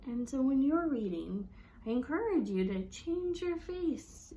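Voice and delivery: ordinary talk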